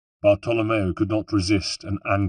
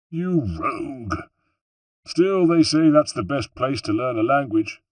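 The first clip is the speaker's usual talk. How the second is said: lightly voiced teasing Bartolomeo; 5 semitones higher than usual. A narrator's reading. Bartolomeo teasing Ezio about the girls, mock scolding. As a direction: tease your friend, grinning.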